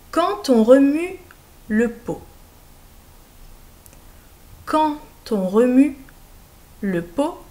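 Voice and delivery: slow word-by-word dictation; dictation reading. Shown as a demonstration. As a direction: read out each group clearly for pupils. Dictée lente, mot par mot. (dictée lente et articulée)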